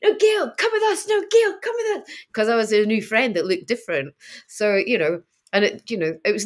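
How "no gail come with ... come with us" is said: put-on high, childlike begging voice; imitating kids pleading, pitched way up. Kids at rock climbing begging Gail to join, high and pleading. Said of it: "They're going".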